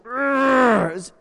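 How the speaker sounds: a high, loud "urgh" of annoyance; angry groan. Retold or imitated. Retelling his pharmacy trip, a loud frustrated groan. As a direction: let out a fed-up groan. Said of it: "I was like".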